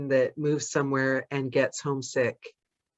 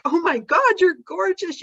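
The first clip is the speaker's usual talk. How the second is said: much higher, gushing at a stranger; gushing compliment. Retold or imitated. Greeting a stranger in the grocery line, high and gushing. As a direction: blurt out delight at a stranger. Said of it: "because I say things like".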